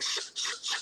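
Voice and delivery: imitating a friend's breathy shush. Hushing his injured friend, voiceless shush. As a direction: hush a crying kid so mom won't hear.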